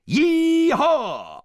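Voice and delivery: very high, loud cowboy whoop; long exultant holler; gleeful whoop. A narrator's reading. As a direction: whoop like riding a bull. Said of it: "whooping gleefully".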